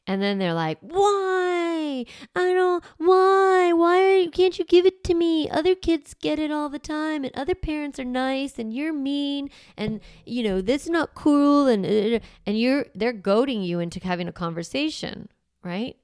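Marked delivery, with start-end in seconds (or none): 0.8-11.7 put-on high, loud whining kid begging for the iPad; high-pitched child whining at a parent who said no; whiny child. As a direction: whine and protest like a wronged child.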